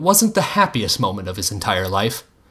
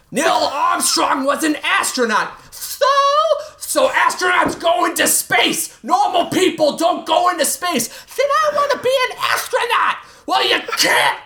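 shouting match. Acted. Acted father-daughter shouting match.

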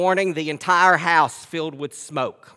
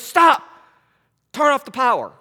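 calm firm order; 7 semitones higher, 4 dB louder than usual. Retold or imitated. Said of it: "And he goes".

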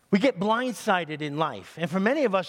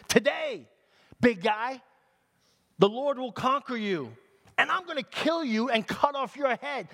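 raised, high-pitched challenge; defiant threat; 6 semitones higher than usual. Acted. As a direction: taunt the giant as young David. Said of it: "He said".

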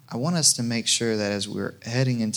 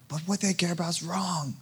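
objection; 8 semitones higher, 3 dB quieter than usual. Retold or imitated. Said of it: "imagined objection".

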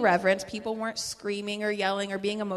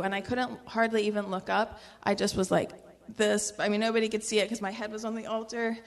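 ordinary talk

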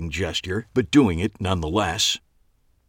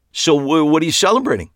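nervous blurt; 10 semitones higher, 7 dB louder, faster than usual. A narrator's reading. Eli blurts to keep her.

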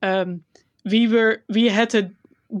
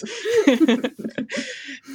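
a bright burst of real laughter up high; 8 semitones higher than usual. Real, not acted. To her co-host about recording the dance sessions, a high laugh. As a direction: laugh it out, amused at the thought.

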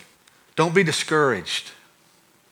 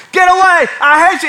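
high, loud furious shrieks; screaming; 9 dB louder than usual. Retold or imitated. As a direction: shriek as if lunging with a knife. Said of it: "she's just screaming at me".

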